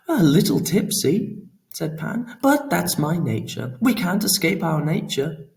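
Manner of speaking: lightly voiced drunken god; tipsy Pan. A narrator's reading. Pan shrugging off Laura, mildly tipsy and merry.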